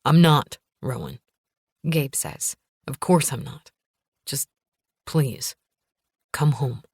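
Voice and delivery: a hint of a tense man on the phone; husband voice. Gabe voiced lower.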